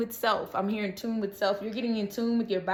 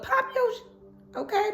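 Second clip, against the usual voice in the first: much higher, slow, teasing; high playful command; 11 semitones higher, slower than usual. Real, not acted. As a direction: stretch it out, teasing.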